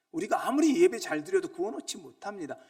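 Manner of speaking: preacher building to the climax; rising preaching. Acted. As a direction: stretch 아무리 long and loud. Repeat builds louder.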